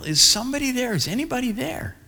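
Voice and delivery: high searching call, fading at the end. Retold or imitated. Pastor calling again for the lost old man. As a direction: call into the woods, urgently hoping. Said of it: "and I yell".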